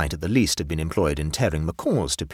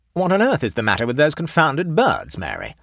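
loud, higher-pitched annoyed outburst; irritated exclamation; 7 semitones higher, 5 dB louder than usual. A narrator's reading. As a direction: exclaim like an exasperated colonel. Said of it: "exclaimed Colonel Hampton".

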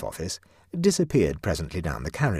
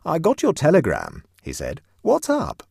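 breezy Birberry; 9 semitones higher, 6 dB louder than usual. A narrator's reading. Birberry voiced.

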